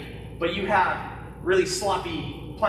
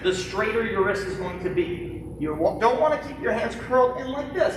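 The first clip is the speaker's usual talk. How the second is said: ordinary talk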